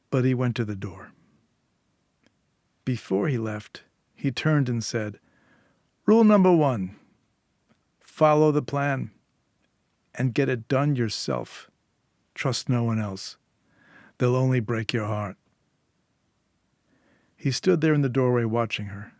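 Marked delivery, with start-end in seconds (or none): 6.1-15.4 parting rule. Acted. Fixer giving Julia his last rule at the door, pointed. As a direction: deliver the first rule like a parting lesson.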